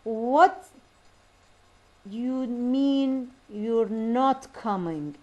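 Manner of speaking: WHAT, MEAN and NOT hit loud and drawn out; very slow "What you MEAN you're NOT coming"; rising WHAT, pause, then stretched beats on MEAN and NOT; rhythm demo. Shown as a demonstration. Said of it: "The stressed syllables over a group of words".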